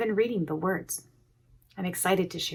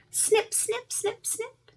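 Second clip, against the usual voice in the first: snip snip pitched up high; squeaky snips; 3 dB quieter, slower than usual. A narrator's reading. Reader to children, high squeaky snip snip. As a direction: snap it out like scissors. Scissors sound effect.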